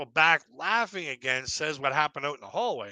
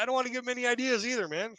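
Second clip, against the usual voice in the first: fast comeback; 10 semitones higher, 3 dB quieter, faster than usual. Retold or imitated. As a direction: rattle it off fast and higher. Quick comeback to manager.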